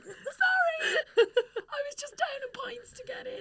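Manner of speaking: high, breathless giggly apology, half whispered; embarrassed laugh. Retold or imitated. Apologising to the Welsh man at the turnstile, giggling and half whispered. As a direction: laugh through the words, mortified.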